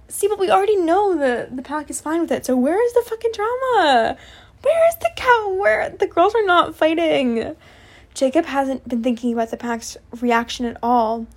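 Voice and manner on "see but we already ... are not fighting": high, hammed-up wail of complaint; mock exasperation. Reader demands drama from the fan fiction, mock exasperated.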